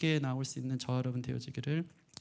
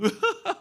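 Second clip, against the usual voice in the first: imitated wail; 6 dB louder than usual. Imitating the child bursting out.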